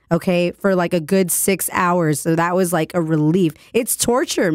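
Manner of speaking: hoarse, gravelly sick voice; raspy voice. Real, not acted. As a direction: rasp through it, voice worn from illness. Sick radio host, raspy throughout.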